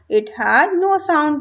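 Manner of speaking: reading English instructions slowly, word by word; slow textbook reading. Shown as a demonstration. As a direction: read it out deliberately for the class. Reads English line slowly. (किताब से धीरे-धीरे पढ़ना)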